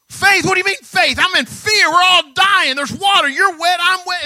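loud, high, frantic shouting; hammed-up panic. Disciples arguing with Jesus in the storm, frantic shouting. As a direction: rant at Jesus like you're drowning. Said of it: "What that interaction would have".

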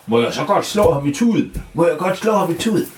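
high, pleading bear voice; high eager teddy bear. Acted. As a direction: beg to be allowed to hit him. Bamse wants to hit wizard.

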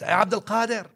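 put-on the drunkard shouting a last time for the sheikh. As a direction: shout after him as he walks away. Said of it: "the man shouts for the last".

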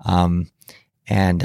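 usual speaking voice

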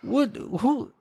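high, softish mock bafflement; baffled reaction. Viewer recalling a baffling film scene, mock-bewildered. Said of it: "I'm like".

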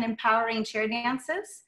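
usual speaking voice

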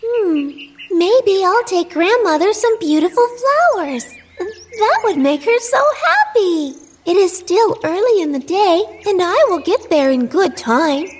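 put-on high, sweet little girl; bright young girl promising. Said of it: "she thought".

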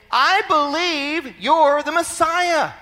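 high, loud, fervent declaration; full-force preaching. Acted. Preacher declaring the beggar's faith in the Messiah, fervently. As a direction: proclaim it with total conviction.

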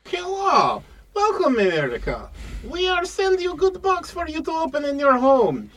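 hammed-up foreign pitchman selling a box; broken-English pitch for a mystery box; mock foreign accent.

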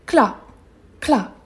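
ordinary delivery, nothing marked

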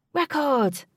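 high loud call; shout. A narrator's reading. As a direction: shout out the record. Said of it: "said a man at the back".

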